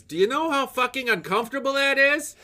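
outraged wife. Retold or imitated. Man quoting his wife on the rock scene, mock outrage. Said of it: "all my wife could say was".

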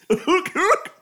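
high, rising hyuck-like hoots; Goofy laugh. Pretend. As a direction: honk it like a cartoon dog. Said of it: "You sounded like Goofy then".

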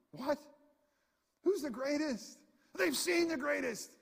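can't believe what they argued over; incredulous. Real, not acted.

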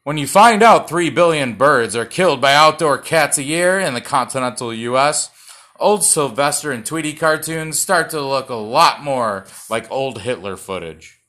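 loud, high host punchline read; loud showman's read of the joke; big joke delivery. Shown as a demonstration. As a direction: sell it like a late-night monologue. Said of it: "take it again".

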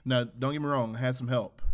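usual speaking voice

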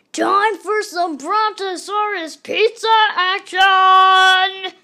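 very loud, slow, stretched hype; hyped announcement. Acted. Narrator kicking off the fight, very loud slow hype. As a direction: announce it like a monster-truck ad.

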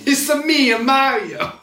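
high slow Italian plumber voice; a playful Mario impression. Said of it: "Let's go to Mushroom Kingdom".

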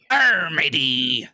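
slow, drawn-out pirate Arr. Pretend. Friend echoing the pirate joke, slow pirate voice. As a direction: stretch out a hammy pirate cry.

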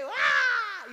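very loud, high hammed-up wail; mock sobbing wail. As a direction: throw out a huge fake cry. Retold weeping on the floor.

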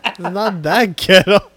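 loud, high denial through laughter; real laugh breaking into the words; laughing protest. Real, not acted. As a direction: protest while cracking up. Laughing denial of friend's joke.

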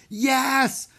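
high, loud crowd yell; screamed. A narrator's reading. Audience answering Harry, screaming. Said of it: "the audience screamed".

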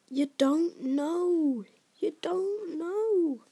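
slow, high, drawn-out disbelief; bewildered disbelief. A narrator's reading. Hagrid realising Harry knows nothing, bewildered. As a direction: repeat it slowly, dumbfounded. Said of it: "with a bewildered stare".

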